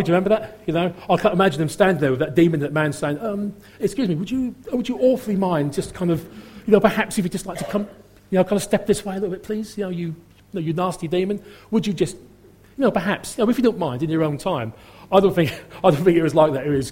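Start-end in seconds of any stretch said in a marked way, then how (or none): 3.2-14.7 higher, hesitant, over-polite mock request; Sergeant Wilson. Preacher imagining Jesus timidly asking a demon to leave, politely dithering. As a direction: dither apologetically, as if afraid to impose. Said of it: "speaking like Sergeant Wilson from, um, Dad's Army".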